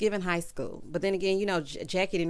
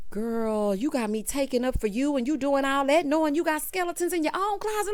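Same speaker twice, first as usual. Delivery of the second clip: exasperated telling-off, climbing higher; mock scolding; 6 semitones higher than usual. Host tells off Jennifer for hiding her own secrets, exasperated scolding. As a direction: scold her in disbelief, pitch building to the end.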